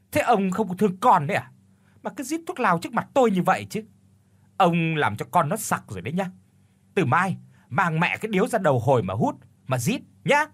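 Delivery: high, pouting whine of reproach; whiny scolding. A narrator's reading. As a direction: coo and nag like a spoiled girl. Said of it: "mụ nói cái giọng nũng nịu như là trách mắng". (nũng nịu trách móc, giọng cao và nhanh)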